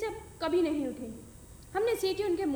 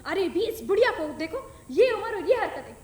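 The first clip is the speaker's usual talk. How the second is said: angry shout at the grandmother; shouting angrily; 2 semitones higher, 6 dB louder than usual. Acted. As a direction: yell at the old woman in outrage. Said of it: "वो चिल्लाकर बोली".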